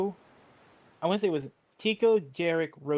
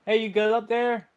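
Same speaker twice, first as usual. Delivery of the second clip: mild mock yell, pitched higher; half-raised shout from below; calling up; 6 semitones higher, 4 dB louder than usual. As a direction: call up to someone high above. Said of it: "I was screaming up to Rosie".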